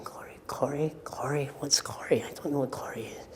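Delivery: panicked inner whisper, fast at the end; hushed, puzzled whisper to yourself; whispered thoughts. Retold or imitated. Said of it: "meanwhile I'm thinking".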